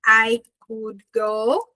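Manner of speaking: each word drawn out slowly; slow teacher demo. Shown as a demonstration. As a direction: spell out I could go at half speed. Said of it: "Eu poderia ir sozinho".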